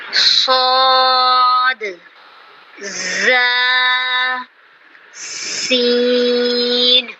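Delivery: صاد, زا and سین held long with a hiss; whistling letters. Shown as a demonstration. Teacher showing the whistling letters, long and hissed. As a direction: draw out each whistling letter. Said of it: "صاد اور سین میں واضح فرق".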